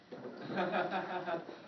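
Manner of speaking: soft rapid chuckle; real quiet giggling laugh; amused laugh. Real, not acted. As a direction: laugh lightly to yourself at the mix-up. Amused by the running "what" exchange.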